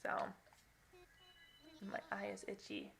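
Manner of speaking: ordinary talk, nothing marked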